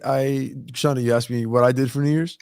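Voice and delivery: ordinary delivery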